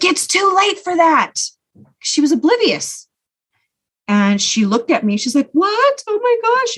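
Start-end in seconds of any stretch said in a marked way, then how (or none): 0.0-1.3 contained scream with pitch jumping up; retold angry scream. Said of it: "so I screamed at her". 5.5-6.8 high-pitched imitated gasp of disbelief; acted shock, pitched way up; mother's shocked reaction.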